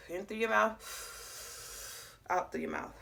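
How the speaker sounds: "In through your mouth" drawn out very slowly, "out" breathed and unvoiced; slow guided breathing. Shown as a demonstration. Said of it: "you're gonna breathe in through your mouth".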